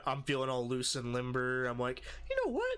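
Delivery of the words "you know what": squeaky-high retold resolve; psyched up. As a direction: shoot the pitch way up, ready for a fight.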